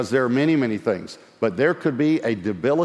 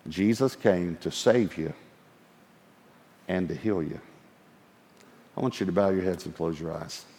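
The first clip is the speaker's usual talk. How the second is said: soft prayer voice; 5 semitones lower, 5 dB quieter than usual. Real, not acted. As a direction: softly lead them into prayer. Moves into prayer time.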